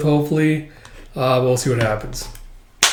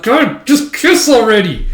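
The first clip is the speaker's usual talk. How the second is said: impatient groan; 7 semitones higher, 5 dB louder, slower than usual. Real, not acted. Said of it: "but I'm like".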